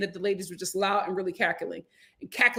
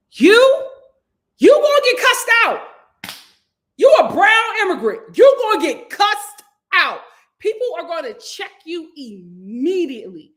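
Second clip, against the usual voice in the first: high, loud, emphatic calling out; loud warning; 8 semitones higher, 9 dB louder than usual. Real, not acted. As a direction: hammer it home loudly, half laughing. Warning brown immigrants bluntly.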